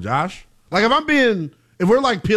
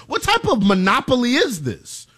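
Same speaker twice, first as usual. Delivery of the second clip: usual speaking voice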